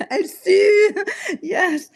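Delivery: high, loud squeal of laughter; laughing. Real, not acted. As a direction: crack up mid-sentence at yourself. Laughing at herself sweating.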